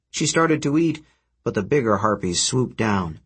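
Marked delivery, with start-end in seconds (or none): none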